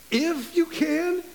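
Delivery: high, slow, rising disbelief; incredulous echo. Retold or imitated. Jesus echoing the father's doubt, incredulous. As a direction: throw the words back in disbelief. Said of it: "I really believe Jesus came back and says".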